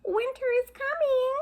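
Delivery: very high, small ant voices; tiny ant voices. Acted. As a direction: squeak it like tiny ants. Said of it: "says the tiny little ant voices".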